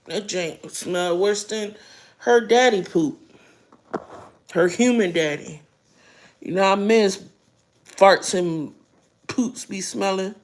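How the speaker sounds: normal talking voice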